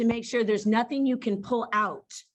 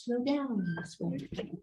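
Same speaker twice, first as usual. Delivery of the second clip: quiet slow down; 9 dB quieter, slower than usual. Shown as a demonstration. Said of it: "you do something like this".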